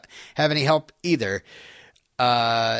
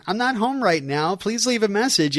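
high-pitched, chirpy voicemail greeting; cheery Clark; 9 semitones higher than usual. Retold or imitated. Clark's recorded greeting to a worried caller, cheery and high. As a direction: put on a sunny, lilting answering-machine voice. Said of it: "we get this cheery Clark basically saying".

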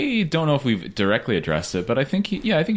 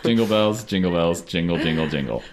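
low mock-festive voice, sinking as it goes; Christmas voice; 6 semitones lower than usual. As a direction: put on a Christmas voice, dropping lower and fading. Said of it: "everyone use your Christmas voices".

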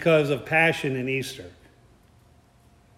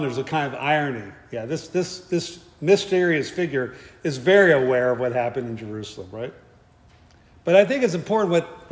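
ordinary delivery

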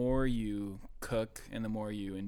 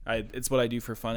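ordinary talk, nothing marked

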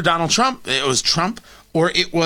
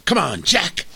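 Joe Biden impression. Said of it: "he's got that impassioned voice, and he goes".

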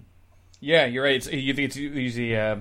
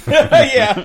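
laughing; 12 semitones higher, 9 dB louder than usual. Real, not acted. Still laughing at the joke.

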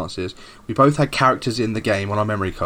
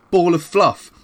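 usual speaking voice

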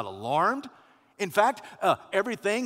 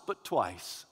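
ordinary delivery

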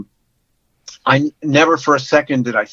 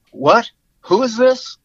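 higher-pitched, incredulous question; retold disbelief; 9 semitones higher than usual. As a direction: imitate someone not buying it. Retelling a suspicious man's phone reaction.

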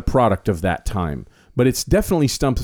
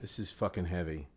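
much softer, almost under breath; quiet; 4 semitones lower, 18 dB quieter than usual. Real, not acted. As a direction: let it drop to a low murmur. Shaken by the song's lyrics.

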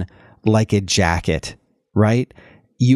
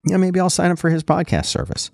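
lightly voiced listener musing aloud, higher; imagined listeners; 6 semitones higher than usual. Host imitates listeners who learned from his videos, lightly voiced musing. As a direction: casually mimic a higher-pitched fan thinking it over. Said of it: "that leads back to people saying".

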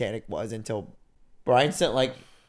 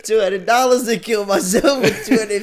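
loud, higher, laughing through it; cracking up at the price, words bursting out; laughing while talking; 7 semitones higher, 8 dB louder than usual. Real, not acted. Joking to friends about the price, laughing loudly.

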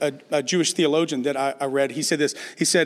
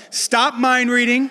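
loud and slow with a laugh; 6 semitones higher, 5 dB louder, slower than usual. Shown as a demonstration. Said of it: "say it to yourself".

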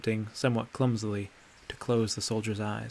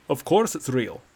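louder, a hint of impatience; impatient; 3 semitones higher, 6 dB louder than usual. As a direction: brush off the question a bit tetchily. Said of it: "with a hint of impatience".

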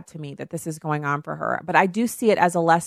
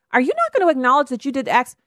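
pointed challenge; 9 semitones higher, 4 dB louder, faster than usual. Retold or imitated. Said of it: "bring it up and say".

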